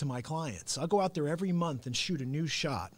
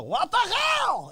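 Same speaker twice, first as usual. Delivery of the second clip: very high, stretched-out mock scream; imitated Russian-accented scream; 14 semitones higher, 6 dB louder, much slower than usual. Imitating girlfriend copying his bit, Russian-accented scream. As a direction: scream it like a copied comic catchphrase. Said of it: "I hear her go".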